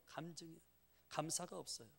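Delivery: usual speaking voice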